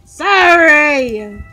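high, loud, drawn-out apology; loud mock apology. Apologizing after being scolded, in a high playful shout. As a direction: holler the apology without restraint.

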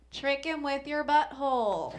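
mock magazine voice. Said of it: "It's always like".

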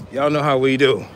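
ordinary talk, nothing marked

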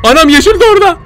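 alarmed cry. Real, not acted. Gamer spotting the green monster, alarmed cry. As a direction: yelp it out at the sight.

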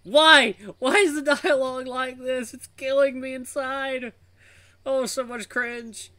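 high, drawn-out wail; anguished cringe wail. Real, not acted. Reader despairs at the dialogue, anguished cringe wail. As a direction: howl it slowly in mock agony.

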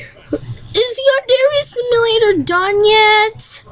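put-on high, slow girly fan voice; Midori voice. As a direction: whine the question sweetly up high. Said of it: "Okay, [name]".

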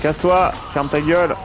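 high loud taunting yell at the enemy; berserk rage. Real, not acted. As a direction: snap at him to shut up. Yelling at boss in fight. (rage contre le boss)

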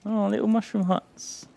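high-pitched cooing delight. Real, not acted. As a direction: coo over something tiny and cute. Cooing over cute game creatures.

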